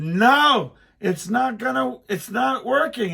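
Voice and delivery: dad yelling at son to stop cutting; frantic shout. Retold or imitated. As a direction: shout no over a running saw. Shouting over chainsaw.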